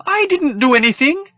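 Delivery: very high, loud pleading robot voice; begging droid. Acted. The droid begs the troopers not to hit him, high and frantic. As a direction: beg like a fussy droid afraid of blows.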